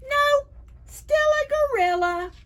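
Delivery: high gorilla-book singsong; playful high refrain. Acted. Reading to kids, sung-out high refrain.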